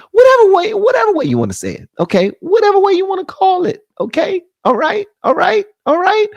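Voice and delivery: riding a wave of giddy excitement; worked-up outburst. Real, not acted. Brushing off the network's name, loud and high.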